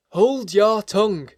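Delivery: slow, loud, high command from jangled nerves; ferocious. A narrator's reading. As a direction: silence her fiercely, drawing the words out. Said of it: "with a ferocity of accent".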